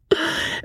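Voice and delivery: breathy high fop's titter; airy unvoiced titter; silly laugh. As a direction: giggle it off like a fool.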